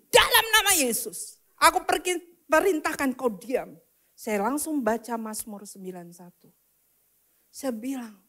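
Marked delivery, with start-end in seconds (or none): 0.1-3.8 high, loud command to a spirit; retold rebuke. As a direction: rebuke forcefully, easing at the end. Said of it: "Saya langsung tengking". (menengking roh dengan suara tinggi)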